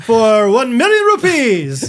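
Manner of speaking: high, loud, slow mock Indian accent. Bidding for a friend's dog, hammed-up Indian accent. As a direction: announce a huge bid in a hammed-up Indian accent.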